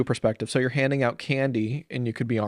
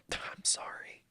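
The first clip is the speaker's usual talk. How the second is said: whispered, embarrassed sorry; whispered apology; 16 dB quieter, slower than usual. Retold or imitated. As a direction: breathe it out as an embarrassed parent. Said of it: "the parent goes".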